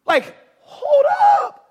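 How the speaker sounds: high, loud, slow hold up; a drawn-out shout of hold up; shocked shout. Real, not acted. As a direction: stop them short in disbelief. Preacher reacts to Martha's words.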